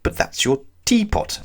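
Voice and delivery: high, puzzled and surprised; bewildered. Acted. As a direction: sound utterly baffled. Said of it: "I said in bewilderment".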